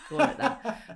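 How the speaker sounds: breathy laugh through the words. Real, not acted. Friend joking about calling a grim show a highlight, laughing. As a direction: laugh it out, half whispered. Laughing at own horrendous recommendation.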